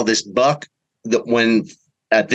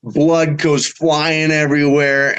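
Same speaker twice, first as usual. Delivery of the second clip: usual speaking voice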